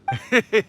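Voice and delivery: a short, knowing chuckle that rises; playful fox laugh; sly chuckle. Acted. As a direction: chuckle slyly before agreeing. Sly laugh before agreeing.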